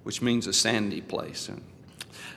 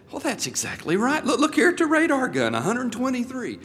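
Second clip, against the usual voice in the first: much higher, astonished policeman; wide-eyed amazement; 13 semitones higher, 4 dB louder than usual. Retold or imitated. Policeman amazed at a truthful speeder, high astonished voice. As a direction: gasp out the disbelief as he checks the radar. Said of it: "S kvadratnymi glazami".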